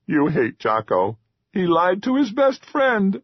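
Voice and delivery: a small creature near tears in shame; high keening troll; upset troll. A narrator's reading. Ashamed troll hiding.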